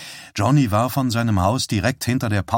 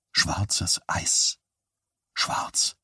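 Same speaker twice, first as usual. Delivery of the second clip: dazed whisper; 3 semitones lower, 6 dB quieter, much slower than usual. Acted. Boy coming round, repeating thought.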